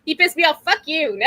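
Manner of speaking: laughing, mock indignant. Real, not acted. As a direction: laugh while cursing out the rumor. Laughing rant at rumor.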